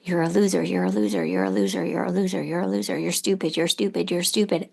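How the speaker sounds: the nagging voice in your head, fading down; voicing the mean inner voice of the father wound; inner critic voice. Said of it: "my voice was always like".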